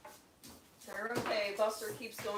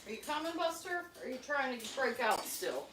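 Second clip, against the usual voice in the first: much higher, softer coaxing; higher soft pet talk; 9 semitones higher, 5 dB quieter than usual. Real, not acted. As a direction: gently call the pet out. Calling the pet softly.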